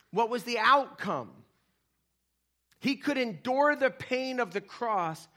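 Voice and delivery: high, loud passionate preaching; preaching at full force. Acted. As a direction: build the sermon to its peak. Preaching climax on the cross.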